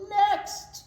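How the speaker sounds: high loud clerk's call; loud counter call. Acted. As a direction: call the next customer. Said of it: "Mister Nevin called out".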